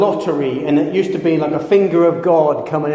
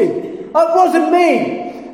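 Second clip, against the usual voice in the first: much higher, very slow pleading whine; innocent kid; 12 semitones higher, much slower than usual. Retold or imitated. Schoolboy protests to the teacher, high and slow. Kid denies stink bomb blame.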